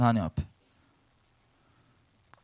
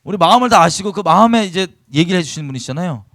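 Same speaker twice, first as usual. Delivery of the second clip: usual speaking voice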